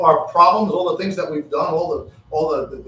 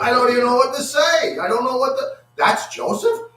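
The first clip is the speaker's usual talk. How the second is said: high-pitched, hammed-up disbelief; dumbfounded; 9 semitones higher, 3 dB louder than usual. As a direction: act stunned, voice climbing to a squeak. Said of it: "he just looked dumbfounded".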